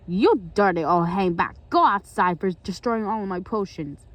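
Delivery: loud, angry villain rant; furious acted telling-off; Gargamel. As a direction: scold at full volume, pitched a bit low. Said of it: "He said".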